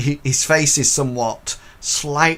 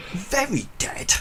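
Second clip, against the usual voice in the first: incredulous inspector; 11 semitones higher than usual. Acted. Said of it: "And he goes".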